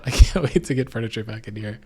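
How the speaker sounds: laughing through the words; chuckling while talking. Real, not acted. Laughing at own echoey room.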